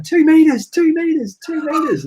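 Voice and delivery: high-pitched imitation of panicked yelling; mock yelling. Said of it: "they're yelling".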